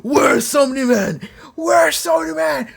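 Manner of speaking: high, drawn-out battle yell; mock screaming. Immortal waking up and hunting Omni-Man, screaming. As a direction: scream it like an enraged warrior. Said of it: "he just starts screaming".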